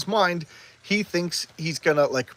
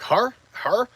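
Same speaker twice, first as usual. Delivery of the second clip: higher-pitched mock disbelief; incredulous question; 7 semitones higher, 4 dB louder than usual. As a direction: imitate someone double-checking in disbelief. Acting disbelief at the chosen girl.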